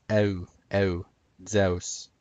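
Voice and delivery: EU as eh sliding into oo, then ZEUS as zeh-oos; gliding vowels. Shown as a demonstration. Presenter demonstrating the diphthong, gliding vowels. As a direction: glide eu together, carry it into Zeus. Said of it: "glide them together".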